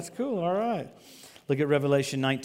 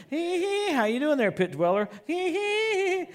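high-pitched gleeful taunt, sing-song; obnoxious taunting; 12 semitones higher, slower than usual. Pretend. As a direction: cackle at him like a gloating loon. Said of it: "I'm gonna be as obnoxious as I possibly can".

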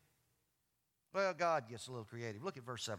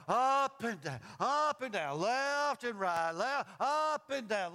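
loud, higher, stretched swaying words; rocking like a ship; 8 semitones higher, 6 dB louder, slower than usual. Shown as a demonstration. Preacher imitating the storm-tossed little ship, swaying singsong. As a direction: heave each word like a rolling wave.

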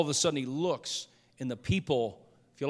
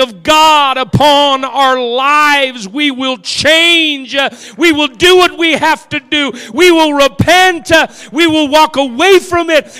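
drawn-out, high, shouted revival declarations; preaching climax; 10 semitones higher, 17 dB louder, slower than usual. Real, not acted. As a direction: cry out each vow to the congregation. Preaching climax, crying out resolve.

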